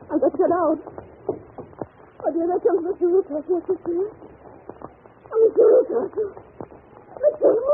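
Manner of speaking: high, loud frightened calls building; rising panic. Acted. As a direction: call out for help in terror. Panicking, calling for help.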